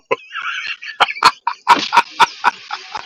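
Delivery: long airy laugh; wheezing laugh at the story; breathy laugh. Real, not acted. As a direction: laugh helplessly, almost silent.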